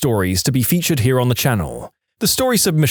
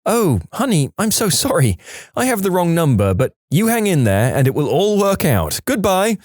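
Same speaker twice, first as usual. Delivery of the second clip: lightly raised, sweet motherly voice; a hint of a comforting grandma; kind old lady; 6 semitones higher than usual. Wrong-number caller comforting Paul, lightly voiced sweet old lady.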